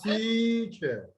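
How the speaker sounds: teacher with a very long, prominent "tea"; slow teacher, all the weight on the first syllable; full first-syllable stress. Shown as a demonstration. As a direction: draw out "tea", then drop onto "cher". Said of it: "all three combined".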